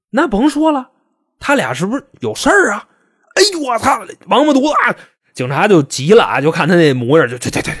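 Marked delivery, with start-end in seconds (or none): 0.1-4.9 much higher, indignant mock cursing; mock angry outburst. Gary turns on the police in anger, mock outburst. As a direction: fire back at the cop, furious. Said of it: "从悲伤转化成了愤怒".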